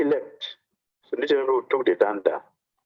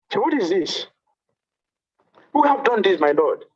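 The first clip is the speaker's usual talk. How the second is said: retold amazed cry, raised and loud; amazed exclaim; 8 semitones higher, 4 dB louder than usual. Devotee finds the broken danda, amazed loud cry. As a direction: gasp it out in disbelief. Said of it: "He was amazed, he was surprised".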